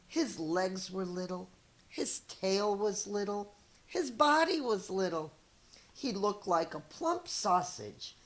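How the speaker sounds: lively read-aloud storyteller voice; story narration. Acted. Teacher reading a picture book to her students, lively storytelling. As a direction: read it to young kids, expressive and clear.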